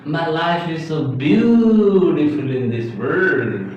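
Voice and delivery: slow, with a long swooping "beautiful"; drawn-out BEAUTIFUL. Shown as a demonstration. Teacher giving a sentence to repeat, drawn-out "beautiful". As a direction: stretch the first vowel of "beautiful" right out. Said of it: "Just try to make this word beautiful".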